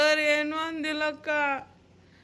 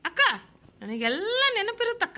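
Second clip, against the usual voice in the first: higher, bashful giggling line; shy laugh; 5 semitones higher, 3 dB louder than usual. Acted. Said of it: "ನಂಗ್ ನಾಚಿಕೆ ಆಕೇತಕ್ಕ". (ನಾಚಿಕೆಯಿಂದ ನಗುತ್ತಾ)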